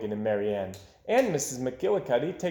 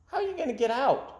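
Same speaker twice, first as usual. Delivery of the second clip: put-on high child's worried question; little boy's voice; 10 semitones higher, faster than usual. A narrator's reading. Little boy asking Mike Mulligan, high and worried. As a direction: ask like a small boy. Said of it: "the little boy said".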